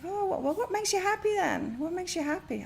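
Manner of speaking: a friend asking what makes her happy, pitched high; imitating the friend. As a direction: lift way up like a wise little friend. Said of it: "She's like".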